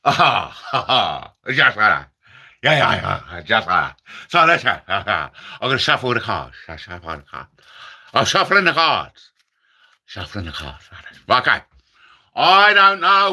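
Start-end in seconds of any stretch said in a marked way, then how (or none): 1.5-4.0 low, growly Tommy Cooper catchphrase; deep comic conjurer voice. Retold or imitated. Comic doing Tommy Cooper's catchphrase, much lower. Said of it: "my Tommy Cooper impression". 4.9-10.8 low mumbling conjurer patter; a hint of Tommy Cooper. Comic voicing Tommy Cooper's card patter, lower. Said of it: "my Tommy Cooper impression".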